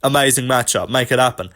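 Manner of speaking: ordinary talk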